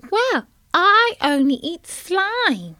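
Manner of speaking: put-on high, slow child tadpole voice; a little tadpole answering the carp in a high voice. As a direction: sound like a small innocent child. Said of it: "said Tim".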